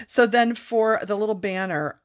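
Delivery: ordinary talk, nothing marked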